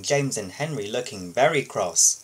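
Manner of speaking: plain reading voice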